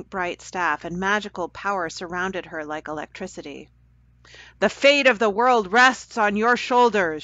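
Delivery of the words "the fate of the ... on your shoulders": loud, higher proclamation; booming. Acted. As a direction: boom it out like a sorceress. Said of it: "she boomed out".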